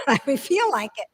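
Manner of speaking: ordinary talk, nothing marked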